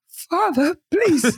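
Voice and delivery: high, pitiful mock plea; hammed-up helpless-child imitation; mocking brother. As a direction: beg in a teasing falsetto. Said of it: "my brother's like".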